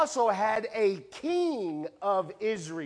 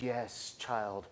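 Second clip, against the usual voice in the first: gentle God; 7 semitones lower, 6 dB quieter than usual. Retold or imitated. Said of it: "And God says".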